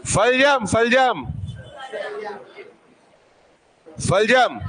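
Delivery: सलजम said slowly, tongue at the palate; drilling सलजम for students; repeated demo word. Shown as a demonstration. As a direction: repeat सलजम, feeling where the tongue goes. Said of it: "उच्चारण करके देखो".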